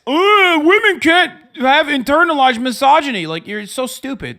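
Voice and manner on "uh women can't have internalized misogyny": high, loud mocking whine of a critic; mocking imitation of an online critic, high and loud. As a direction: parody the dumb critic, much higher. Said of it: "That's what you sound like".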